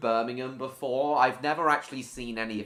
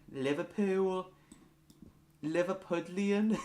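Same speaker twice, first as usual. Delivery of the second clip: high, questioning mock Scouse; lilting Welsh-tinged Liverpool accent; Liverpudlian accent attempt; 8 semitones higher than usual. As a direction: try Scouse and land near Welsh. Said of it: "I don't know if that was Welsh or Liverpudlian".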